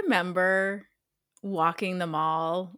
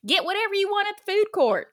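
high, fast, loud mom voice; mom's real voice; 11 semitones higher, 3 dB louder, faster than usual. Retold or imitated. Mom dropping her at the mall, quick and loud. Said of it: "'cause that's how she sounded".

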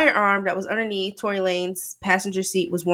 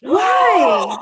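loud indignant why; 10 semitones higher, 7 dB louder than usual. Real, not acted. Friend says she has no sympathy.

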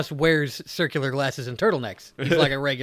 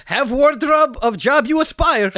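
mock Eastern European; 9 semitones higher, 4 dB louder than usual. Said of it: "dangerously close to just doing Borat".

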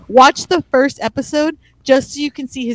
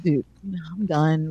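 low, soft retold surrender; deflated mock refusal; fed-up dismissal; 8 semitones lower, 7 dB quieter, slower than usual. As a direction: give up on him under your breath.

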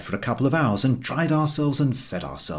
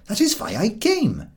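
put-on higher, gentle old man; kindly old gentleman; 10 semitones higher than usual. The old gentleman reassures the walkers, high and gentle. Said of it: "said the old gentleman".

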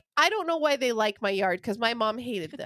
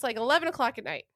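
ordinary delivery

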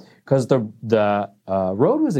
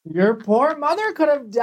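much higher, hammed-up scolding; mock outrage; 12 semitones higher than usual. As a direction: scold her in playful horror. Mock scolding friend for laughing.

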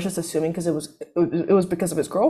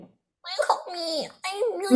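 put-on high, soft, drawn-out baby voice; mock a helpless man acting like a baby; slow, exaggerated pleading whine; whiny baby; 12 semitones higher, 4 dB quieter, slower than usual. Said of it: "acting like babies and being like".

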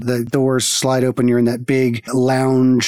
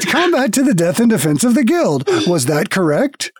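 trolling automaton; 6 semitones higher than usual. Acted. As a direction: feign machine politeness while mishearing. Automaton keeps mishearing.

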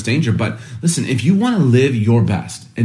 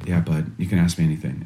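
gentle reassurance; 6 semitones lower, 7 dB quieter than usual. Retold or imitated. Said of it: "I'm like".

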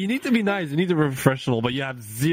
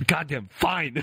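grudging comic surrender, higher pitch; mock upset; 5 semitones higher, slower than usual. Streamer retelling giving in to husband, mock upset. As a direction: give in with huffy mock annoyance. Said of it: "I'm so, like, upset".

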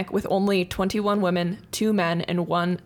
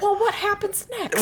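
mock dazed lady; 12 semitones higher than usual. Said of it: "She's like".

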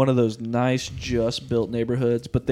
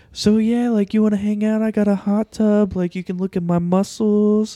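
higher-pitched, mock come-on voice; imitated flirty caller; 8 semitones higher than usual. Caller inviting the call center worker over, imitated flirty pitch. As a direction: imitate an over-eager caller making advances.

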